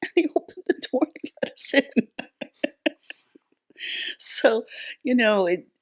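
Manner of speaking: laughing while telling the punchline; high-pitched laugh breaking into the words, then a breathy trailing off. Real, not acted. Retelling a funny jail-guard win.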